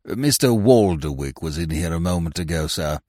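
low, deferential servant's voice; reserved attendant reporting to a member; club attendant. Acted. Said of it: "said the attendant".

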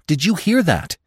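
a frightened fawn insisting on the danger; urgent repeat. A narrator's reading. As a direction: press the warning harder. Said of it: "Bambi repeated".

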